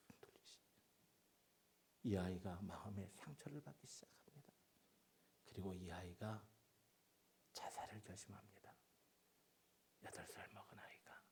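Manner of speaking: soft whispered sorrow; hushed whisper. Real, not acted. Preacher telling of the child's despair, whispered. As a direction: whisper the tragedy. Tragic turn told hushed.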